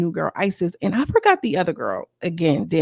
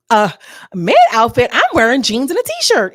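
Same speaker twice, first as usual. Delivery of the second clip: loud, higher-pitched outraged retort; indignant protest; 5 semitones higher, 8 dB louder than usual. Real, not acted. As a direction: fire back loudly in disbelief. Indignant reply to viewer's jab.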